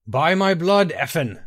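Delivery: loud ritual summons; loud invocation. A narrator's reading. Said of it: "the Nightingale cried".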